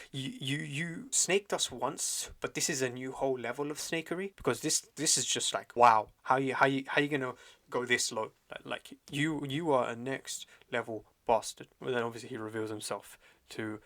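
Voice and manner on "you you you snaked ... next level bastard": appalled disbelief. Retold or imitated. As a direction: act out stunned indignation at a betrayer. Acting out the others' outrage at betrayal.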